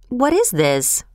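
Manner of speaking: mild startled confusion; confused cry. A narrator's reading. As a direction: ask it as if caught off guard. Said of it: "cried Mister Lorry in confusion".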